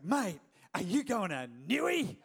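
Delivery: hammed-up broad yobbo Australian accent. Eager friend pestering the pastor at his office, broad yobbo Australian. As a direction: play a really yobbo Aussie, stretching Newey. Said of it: "like, really, really yobbo Australian".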